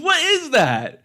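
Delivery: drawn-out baffled laugh; laughing disbelief. Real, not acted. Shocked laugh at sound effect.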